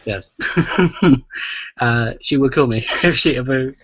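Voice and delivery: usual speaking voice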